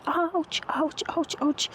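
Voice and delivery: sharp high yelps of ouch, as if pinched; pinched and crying ouch again and again; yelping ouch. A narrator's reading. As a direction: yelp out each ouch high and hurt. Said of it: "ज़ोर से चिल्लाता है रितेश".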